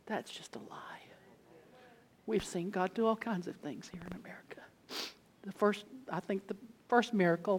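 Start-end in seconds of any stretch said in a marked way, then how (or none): 0.1-4.6 intense whisper; whispered emphasis. Acted. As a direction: lean in and whisper it hard. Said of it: "emphatic aside".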